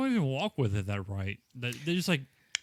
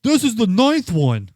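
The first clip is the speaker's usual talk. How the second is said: gruff, slow and loud; 9 semitones higher, 12 dB louder than usual. Retold or imitated. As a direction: boom it out slowly like a guard. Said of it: "she's like".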